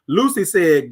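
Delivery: ordinary talk, nothing marked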